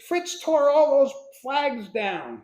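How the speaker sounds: high, slow, outraged report; dad fuming at the boy, drawn out and high; retold outburst. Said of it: "and he says".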